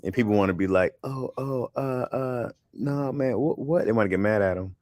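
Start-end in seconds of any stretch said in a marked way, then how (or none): 1.0-3.8 imitating a flustered guest, higher pitched; mock flustered. Radio host mimics guests caught by questions, mock flustered. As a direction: stammer it out in mock protest.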